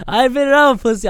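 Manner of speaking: high, loud, fast through laughter; laughing. Real, not acted. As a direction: blurt it out laughing. Laughing self-admission.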